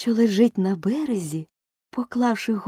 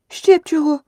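high, loud defiant snap; curt retort; 11 semitones higher, 9 dB louder than usual. Acted. Alice defiantly retorts to the queen, sharp snap. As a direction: cut her off sharply. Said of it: "одрізала Аліса".

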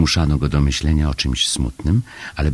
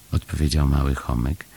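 usual speaking voice

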